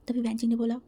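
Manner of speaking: ordinary delivery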